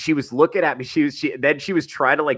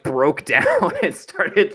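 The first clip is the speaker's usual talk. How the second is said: normal talking voice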